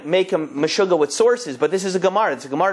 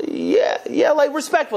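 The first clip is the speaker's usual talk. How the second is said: much higher, relieved yes; eager agreement; 10 semitones higher than usual. Retold or imitated. Joey agreeing with the rabbi's word, eager and much higher. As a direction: pounce on the word he was looking for.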